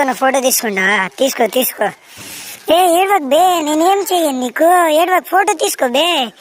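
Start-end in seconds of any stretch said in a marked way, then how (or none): 2.7-3.6 higher, louder coaxing "ఏడవకు" with a rising end; don't cry, said up high and coaxing; hushing a crier. Acted. Soothing a crying child. 3.6-6.3 coaxing a crier. Acted. Soothing a crying child.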